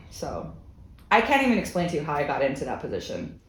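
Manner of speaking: ordinary delivery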